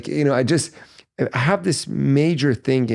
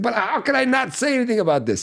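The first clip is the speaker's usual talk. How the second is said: imitating dad; 11 semitones higher, faster than usual. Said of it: "He's like".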